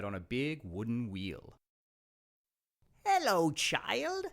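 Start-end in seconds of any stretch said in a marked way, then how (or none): 3.0-4.3 high, slow witch voice; old crone. Acted. Old woman luring the princess, high slow croon. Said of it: "said the old woman".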